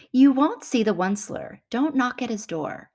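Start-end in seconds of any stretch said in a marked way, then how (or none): none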